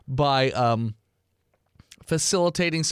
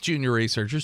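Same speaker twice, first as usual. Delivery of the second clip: usual speaking voice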